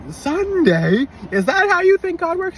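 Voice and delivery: high, loud disbelieving demand; mock his idea of God; retold outraged disbelief; incredulous scolding. Scolding the driver in street.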